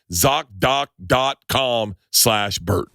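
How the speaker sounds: slow, spelling the ad URL; drawn out so listeners catch it; slow deliberate read. Acted. Host repeating the sponsor web address slowly.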